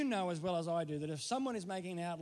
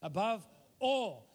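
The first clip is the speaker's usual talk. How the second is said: "Above all" called out loud and slow, drawn out; 3 semitones higher, 6 dB louder, slower than usual. Shown as a demonstration. Preacher urging the church to repeat it, loud and slow. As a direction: belt "Above all" slowly to lead the church. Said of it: "Go on, go and say it again".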